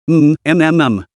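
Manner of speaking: strangled moans through a tight throat; choked hum behind a restraint; muffled choking hums. Acted. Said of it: "The muffled sounds came from".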